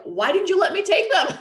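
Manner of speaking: high, loud, laughing husband impression; husband teasing his frazzled wife; husband's mock complaint. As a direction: lampoon the exasperated husband, laughing. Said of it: "my husband's like".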